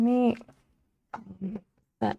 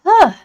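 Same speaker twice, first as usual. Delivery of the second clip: loud high annoyed ugh; frustrated groan; 9 semitones higher, 15 dB louder than usual. Real, not acted.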